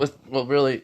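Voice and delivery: ordinary delivery, nothing marked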